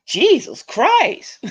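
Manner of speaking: slow, drawn-out exasperated sigh of words; slow, high, fed-up groan. Retold or imitated. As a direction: drag it out, fed up. Exasperation at friend.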